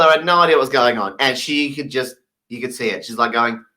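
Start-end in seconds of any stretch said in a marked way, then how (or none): none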